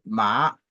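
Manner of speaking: "ma" gliding upward; drawn-out rising "ma". Shown as a demonstration. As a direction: start "ma" low and let it climb. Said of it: "ça monte".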